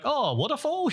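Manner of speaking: high-pitched, questioning tourist voice; imitated Japanese tourists. Driver imitating lost tourists asking for a waterfall, high-pitched questioning. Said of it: "three Japanese tourists being like".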